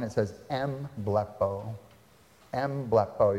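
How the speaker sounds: em blepo, slow, with the em syllable clearly sounded; Greek word em blepo. Shown as a demonstration. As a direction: em blepo said carefully, em then blepo. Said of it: "You hear there's a syllable at the beginning?".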